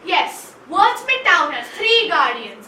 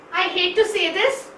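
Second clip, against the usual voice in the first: ordinary talk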